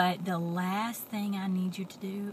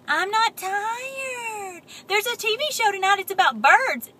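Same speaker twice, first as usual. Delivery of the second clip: whiny crying; 10 semitones higher, 7 dB louder than usual. Acted. Pigeon pleading quote.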